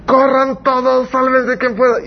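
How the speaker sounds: king telling everyone to run, hammed-up panic; panicking king. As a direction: cry out like a frightened king abandoning his men. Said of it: "el de autoridad dice".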